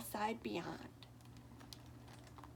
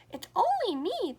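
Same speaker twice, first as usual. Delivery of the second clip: higher, louder little goat voice; teeny voice; 9 semitones higher, 9 dB louder than usual. Acted. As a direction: squeak it out like the tiny goat. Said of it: "answered in his teeny voice".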